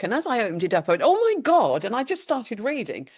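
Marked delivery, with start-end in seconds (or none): 1.0-1.8 amazed gasp. Retold or imitated. Reading the book, sudden realisation.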